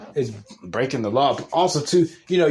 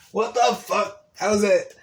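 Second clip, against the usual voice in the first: incredulous; 6 semitones higher than usual. Retold or imitated. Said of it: "I was like".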